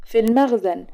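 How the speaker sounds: usual speaking voice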